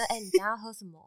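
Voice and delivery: hushed voice close to the ear; soft, intimate murmur in someone's ear; ear whisper. Retold or imitated. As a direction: lean in and murmur it softly. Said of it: "在你耳边小声".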